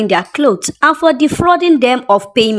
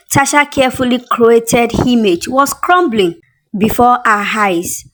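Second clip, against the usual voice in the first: usual speaking voice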